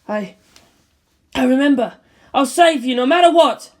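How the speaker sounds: rising to a loud cry; a pilot screaming her vow; screamed promise. Acted. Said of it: "she screamed".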